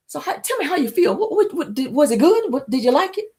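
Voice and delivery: high, put-on voice of a smug lover; mock smug man. Mocking the man asking if she liked it. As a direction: ham up his fishing for praise.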